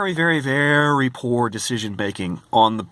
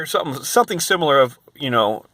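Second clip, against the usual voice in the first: ordinary talk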